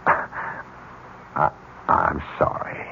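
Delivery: whispered, slow apology. Acted. Con man whispering a sly apology to Van Haas.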